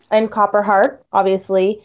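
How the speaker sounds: ordinary delivery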